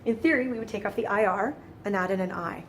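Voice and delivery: ordinary delivery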